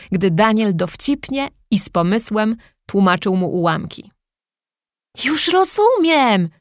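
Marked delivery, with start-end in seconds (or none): 5.2-6.5 high, slow burst of delight; the penny finally drops, with joy; joyful exclaim. A narrator's reading. Boy finally gets fractions, high joyful cry. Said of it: "wykrzyknął chłopiec z radością".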